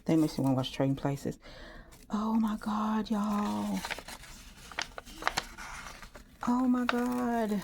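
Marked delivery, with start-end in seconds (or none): 2.1-7.6 hushed, higher oh-my-God; overwhelmed, drawn-out near-whisper; awestruck. Real, not acted. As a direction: breathe it out slowly in disbelief. Overwhelmed by how well it turned out.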